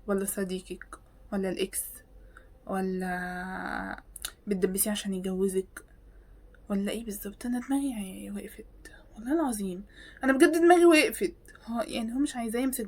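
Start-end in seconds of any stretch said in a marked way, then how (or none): 0.1-7.5 whisper. Real, not acted. Whispered list of options. 7.5-9.8 whispered exasperation. Real, not acted.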